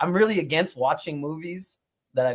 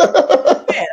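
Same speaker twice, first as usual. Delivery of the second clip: higher, louder protesting outburst; emphatic protest; 11 semitones higher, 8 dB louder than usual. Retold or imitated. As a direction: push back hard on his wife. Retold protest to wife.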